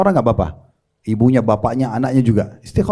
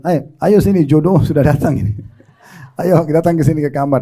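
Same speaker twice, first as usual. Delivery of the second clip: retold eager summons. Preacher phoning the sleepy bachelor to come meet his match, eager. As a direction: call him over, lightly excited and amused.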